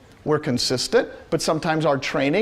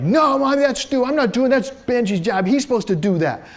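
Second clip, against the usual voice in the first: raised, higher-pitched mock yell at mom; retold yelling; 5 semitones higher than usual. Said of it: "he just yelled at her".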